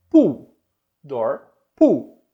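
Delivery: "door" and "pool" set side by side, same double o but different vowels; contrast demo. Shown as a demonstration. Teacher contrasting "door" and "pool" for students. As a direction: hit "door" with an open o and "pool" with a long u. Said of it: "Então eu tenho door".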